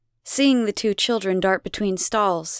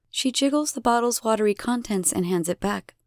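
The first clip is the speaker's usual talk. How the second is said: plain reading voice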